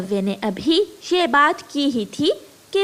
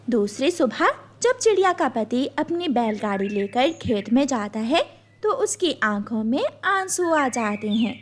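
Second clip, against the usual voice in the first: ordinary talk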